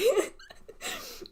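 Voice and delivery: squeaky high giggle; high laugh. Real, not acted. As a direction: giggle at yourself. Laughs at own hiding habit.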